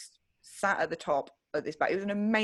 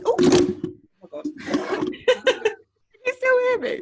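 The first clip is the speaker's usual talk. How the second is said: got excited; 8 dB louder, much slower than usual. Real, not acted. Reliving her panic attack for her friend, laughing as it cracks. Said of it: "I just got a bit excited".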